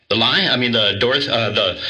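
normal talking voice

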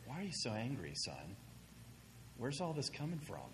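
gentle coach. Retold or imitated. Coach gently questions angry player.

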